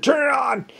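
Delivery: sharp high shouted command at gunpoint; barked order. Retold or imitated. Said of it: "and said".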